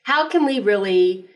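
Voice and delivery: normal talking voice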